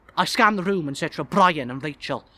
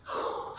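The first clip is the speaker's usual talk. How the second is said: long audible breath out; deep breath; 8 dB quieter than usual. A narrator's reading. Man calming himself before a party, deep breath. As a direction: let out a steadying sigh. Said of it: "I take a deep breath".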